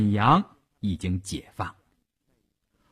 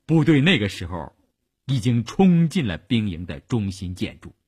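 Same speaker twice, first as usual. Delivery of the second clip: plain reading voice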